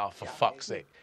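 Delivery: a fed-up sigh of a curse; quiet exasperated swearing; exasperated mutter. Retold or imitated. Exasperated reaction mid police raid.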